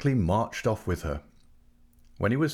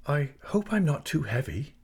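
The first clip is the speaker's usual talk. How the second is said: lightly voiced timid girl, higher; a touch of shyness, worried she's a burden; 6 semitones higher than usual. A narrator's reading. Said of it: "she said timidly".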